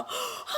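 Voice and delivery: sharp high gasp of fright; frightened gasp. Acted. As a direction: gasp in terror. Baby sees monster.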